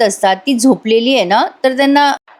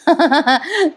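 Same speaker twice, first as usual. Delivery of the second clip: short, higher-pitched chuckle; amused chuckle; 6 semitones higher than usual. Real, not acted. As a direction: chuckle at someone caught out. Amused at exposed fib.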